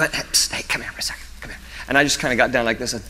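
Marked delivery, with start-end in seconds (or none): 0.0-1.6 secret hushed call; whisper. Retold or imitated. Preacher secretly calling the boy over, whispered.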